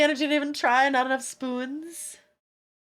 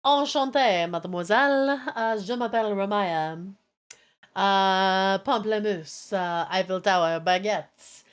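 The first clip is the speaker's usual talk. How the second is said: lower, hammed-up French accent; bad French accent; 5 semitones lower than usual. Streamer stuck on a one-minute French timer, bad French accent. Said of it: "This is the French".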